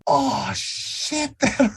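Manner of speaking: high, strained yelp of pain; mock pain cry. Character shot in the knee, high pained yelp.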